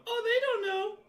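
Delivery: tiny high-pitched creature squeak; small voice. Acted. Game master voices an unseen creature behind the players, small voice. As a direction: pipe up very high, like something little. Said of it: "You'll hear a small voice from behind you go".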